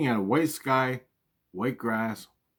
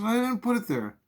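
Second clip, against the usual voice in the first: mom's retort; 12 semitones higher than usual. Retold or imitated.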